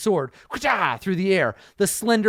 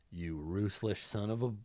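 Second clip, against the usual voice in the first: quiet seething curse; 8 semitones lower, 14 dB quieter than usual. Acted.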